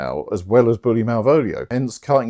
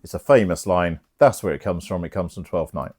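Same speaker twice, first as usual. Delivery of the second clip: ordinary talk, nothing marked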